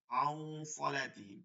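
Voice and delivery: আউ সলাতিহিম drawn out slowly with a nasal আউ; slow recitation. Shown as a demonstration. Teacher recites an example for the students. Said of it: "ঠিক আছে?".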